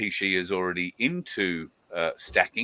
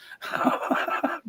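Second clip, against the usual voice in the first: breathy chuckle. Real, not acted. Laughing at bar joke.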